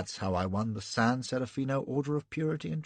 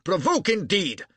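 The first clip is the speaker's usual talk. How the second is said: high, louder scornful outburst; indignant; 9 semitones higher, 6 dB louder than usual. Acted. Broadfoot scoffing at Hardinge's caution, indignant. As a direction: spit it back with scorn.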